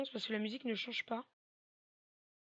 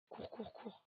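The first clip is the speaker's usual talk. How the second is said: softer, whispered run run run; 8 dB quieter, much slower than usual. Real, not acted. Sneaking past the singing ghost, whisper. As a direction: urge yourself silently.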